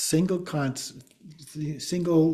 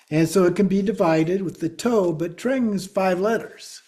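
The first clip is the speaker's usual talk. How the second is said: normal talking voice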